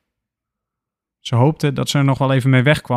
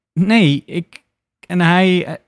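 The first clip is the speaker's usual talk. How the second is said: stammering; 5 semitones higher, slower than usual. Acted. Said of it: "stamelt ze".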